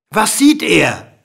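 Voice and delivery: slow, loud question; insistent calling. A narrator's reading. King pressing the straining dwarf, calling out slowly. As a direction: call it out word by word.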